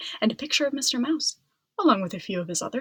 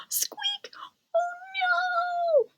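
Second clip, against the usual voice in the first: very high squeaky cry; alarmed mouse; much slower than usual. A narrator's reading. Mouse seeing the broken tusk, high squeaky alarm. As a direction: gasp then squeak in panic. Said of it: "Mister Mouse yelled".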